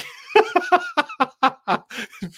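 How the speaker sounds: real, sudden laugh at the joke; big high laugh. Real, not acted. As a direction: burst out in a loud, high-pitched laugh. Reacting to G I Joe movie joke.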